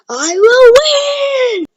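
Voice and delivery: battle cry. Retold or imitated. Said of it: "and it's like".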